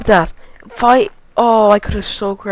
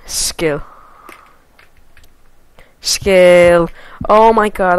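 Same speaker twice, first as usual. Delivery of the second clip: ordinary talk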